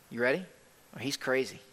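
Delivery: low, soft aside; understated, letting it sink in; hushed alternative. Real, not acted. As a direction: drop "crazy" down quietly. Preacher drops the alternative.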